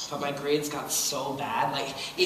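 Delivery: ordinary talk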